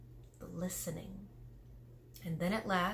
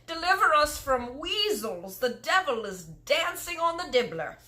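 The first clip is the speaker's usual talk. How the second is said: louder, higher, slow anguished giant cries; fury and anguish; 9 semitones higher, 6 dB louder, slower than usual. Acted. Giant raging over caught nightmare, anguished shout. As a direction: rage and despair together. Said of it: "filled with fury and anguish".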